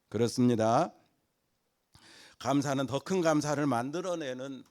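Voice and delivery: loud, ringing sermon delivery; fiery preaching. Acted. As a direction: preach it out to the whole congregation. Preaching at full force.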